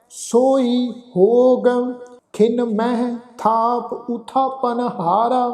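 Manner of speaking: slow, measured recitation with long held vowels; scripture recitation. Acted. Teacher recites Gurbani to the congregation, slow scripture recitation. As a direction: draw out each phrase end on a level tone.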